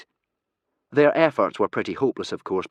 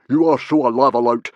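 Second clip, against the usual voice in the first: very loud, raised accusation; exclaimed; 5 semitones higher, 11 dB louder than usual. A narrator's reading. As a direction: insist forcefully on the accusation. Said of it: "exclaimed Stoic".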